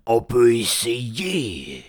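troll, game to try. Acted. Hubert proposing to eat the hobbit, slow troll voice. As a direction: drag it out as a big troll.

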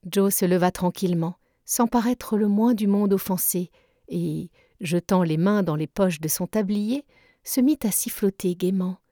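plain reading voice

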